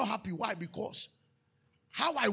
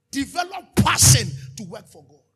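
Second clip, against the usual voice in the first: much louder, ringing call; preaching shout; 12 dB louder than usual. Acted. Pastor commanding the church, loud preaching shout.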